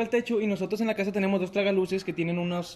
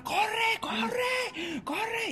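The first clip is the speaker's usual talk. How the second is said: high yelled run run run; high urgent yell, trailing off; retold shouting; 5 dB quieter, much slower than usual. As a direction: call down urgently from the roof. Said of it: "Y yo le gritaba desde arriba".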